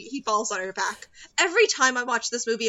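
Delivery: ordinary delivery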